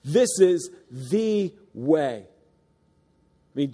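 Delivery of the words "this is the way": slow, every word weighted; slow emphatic. Shown as a demonstration. Preacher answering his own question, slow and firm. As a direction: lay it down slowly. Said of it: "You want to be saved?".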